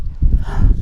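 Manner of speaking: sudden frightened intake of breath; sharp gasp. Acted. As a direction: gasp in alarm. Sees circling buzzards.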